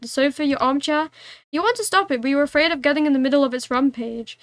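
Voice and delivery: plain reading voice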